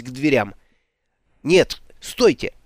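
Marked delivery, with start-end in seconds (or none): 1.4-2.5 urgent. Acted. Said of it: "подскочил Алекс".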